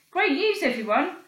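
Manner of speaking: high, loud cheerful call; announcement. Acted. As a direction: announce it proudly. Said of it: "announced George".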